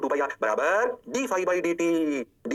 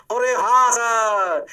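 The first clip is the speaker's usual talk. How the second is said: high, loud, very drawn-out "yes sir"; booming stretched realization; mock student agreement; 6 semitones higher, 6 dB louder, much slower than usual. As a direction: belt out a slow chorus of agreement. Acts out class's drawn-out agreement.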